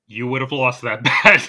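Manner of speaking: breaking into a loud laugh; laughing tease. Real, not acted. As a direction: tease through rising laughter. Laughing over the lost bet.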